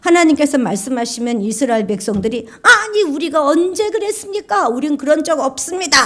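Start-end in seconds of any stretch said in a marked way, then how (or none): 2.6-6.0 indignant protest. Acted. Israelites protest to God.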